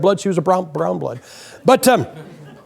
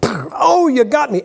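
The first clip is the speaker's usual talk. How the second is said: lightly hammed-up dying gasp; a hint of old-movie death line; mock dying cowboy; 6 semitones higher, slower than usual. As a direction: play a Western extra getting shot, half-hearted. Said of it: "and he'd go".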